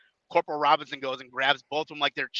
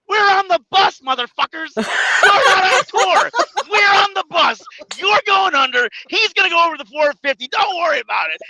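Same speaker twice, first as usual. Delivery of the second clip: much higher, loud shout, laughing through it; 12 semitones higher, 10 dB louder than usual. Retold or imitated. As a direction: yell it at the rude wrestlers. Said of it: "Vito turns around and goes".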